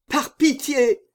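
high, urgent begging; desperate plea. A narrator's reading. Executioner holds out his arms for mercy, high desperate plea. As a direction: cry out for mercy.

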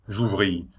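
plain reading voice

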